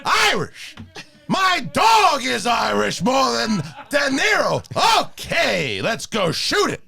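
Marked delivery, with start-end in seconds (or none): 0.0-4.6 yelling Al Pacino. Acted. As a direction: rant like late-career Pacino. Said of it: "The yelling?".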